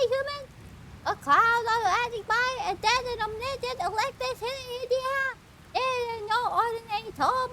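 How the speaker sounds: high quirky creature voice; odd creature voice. Acted. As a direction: ask it like a curious little critter. Voicing NPC.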